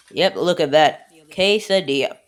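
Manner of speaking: usual speaking voice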